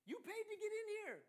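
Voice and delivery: high, loud laughing jab; joking with laughter. Retold or imitated. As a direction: tease about the price, laughing. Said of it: "I made a joke about how much it cost".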